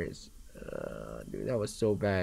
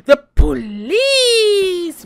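gasp, then high loud slow cry; relieved gasp; 12 dB louder, much slower than usual. Real, not acted. Gasps seeing the police arrive.

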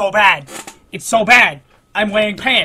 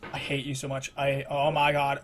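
low, soft exasperation; defeated muttering; 6 semitones lower, 8 dB quieter than usual. Real, not acted. Player cursing the game, quiet and defeated.

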